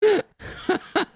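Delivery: high-pitched helpless cackle; real laughter. Real, not acted. Cracking up at own comeback, high laughter. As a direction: laugh hard at your own joke.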